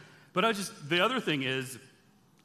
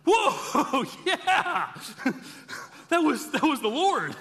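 much higher, louder, laughing with awe; stunned shock; 11 semitones higher, 5 dB louder, much slower than usual. Retold or imitated. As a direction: blurt it out as an overwhelmed Manoah. Manoah realizing it was God.

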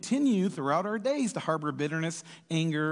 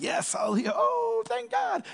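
sudden high excited cry of relief; overjoyed relief; much slower than usual. Retold or imitated. Servant whose huge debt is forgiven cries out in high excited relief. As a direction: burst out with disbelieving joy.

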